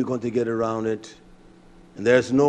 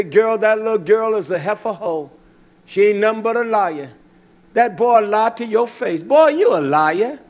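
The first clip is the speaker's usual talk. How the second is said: put-on old folks scolding; 7 semitones higher than usual. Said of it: "They would say it just like that".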